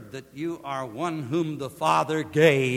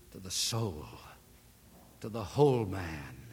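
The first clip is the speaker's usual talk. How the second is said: lower, softer, reverent closing prayer; 6 semitones lower, 3 dB quieter than usual. Acted. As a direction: pastor leading the congregation in prayer.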